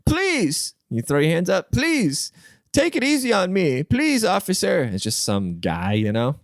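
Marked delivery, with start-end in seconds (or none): 0.0-4.8 high hammed-up begging voice; mock pleading. Podcaster plays a caught criminal, high mock pleading. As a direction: beg in a high mock panic. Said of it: "you're just like".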